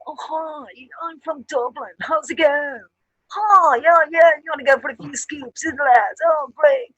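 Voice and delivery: hammed-up poshy Dublin 4 girl; posh Dublin 4 accent. Dubliner mocking southside posh girls, in a high posh Dublin 4 accent. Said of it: "poshy little".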